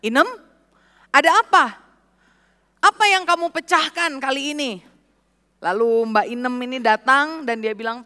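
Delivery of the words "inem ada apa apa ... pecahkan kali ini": a retold startled call, raised and higher; startled shout. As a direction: call out to the maid in alarm. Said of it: "dia teriak".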